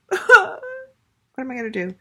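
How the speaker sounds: high, airy mock wail; playfully hammed-up dismay; mock despair. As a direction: act out an overwhelmed "oh no". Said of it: "sort of like".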